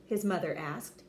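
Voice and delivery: usual speaking voice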